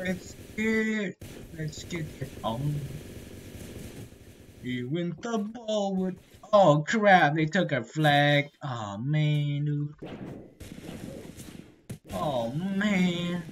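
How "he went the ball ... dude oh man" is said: ordinary talk, nothing marked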